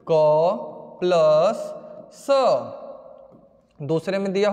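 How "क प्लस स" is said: each part drawn out slowly; slow teacher spelling; slow spelled out. Shown as a demonstration. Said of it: "ए विकल्प में दिया है".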